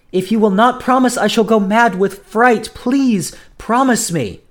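high, loud, urgent begging; frightened pleading. A narrator's reading. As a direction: beg desperately, voice pitched right up. Julia begs him, mad with fright.

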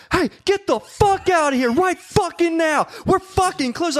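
high, drawn-out panicked yell; frantic reenacted order to leave; retold freaking out. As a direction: yell it high and slow, word by word. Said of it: "I was, like, freaking out".

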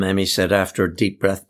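ordinary delivery, nothing marked

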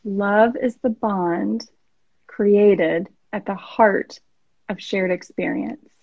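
slow, measured repeat with pauses between phrases; slowly. Shown as a demonstration. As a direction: let each phrase soak in, unhurried. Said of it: "Say it slowly for me".

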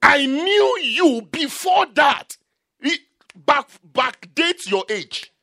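loud, high, emphatic sermon delivery; full-force preaching. Acted. Preacher telling the congregation God knew them early, full-force preaching. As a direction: preach it out with drawn-out, forceful words.

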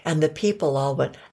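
ordinary delivery, nothing marked

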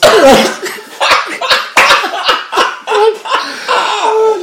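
big loud high burst of laughter. Real, not acted. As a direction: howl with laughter at the punchline. Burst after his own punchline.

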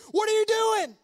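high, sustained preacher's shout; ringing self-exhortation at high pitch; shouting yourself into encouragement. Acted. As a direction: call it out high, scolding your own soul. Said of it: "you need to shout yourself into encouragement".

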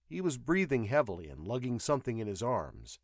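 ordinary delivery

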